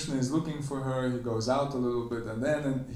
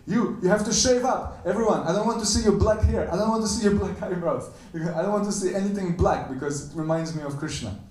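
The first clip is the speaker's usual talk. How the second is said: high, loud furious cry; Radha's jealous, shrieking anger; angry outburst; 8 semitones higher, 6 dB louder than usual. Retold or imitated. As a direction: shout her away in a jealous rage. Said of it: "even though she's very angry".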